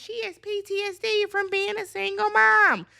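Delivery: high-pitched mimicry of online defenders; mock commenter. Commenters jumping in to defend Huda, mocked in a high voice. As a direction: parody a protesting commenter, pitched well up.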